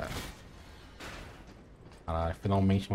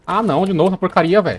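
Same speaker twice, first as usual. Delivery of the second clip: annoyed; 7 semitones higher, 7 dB louder than usual. Real, not acted. Hit by attack again.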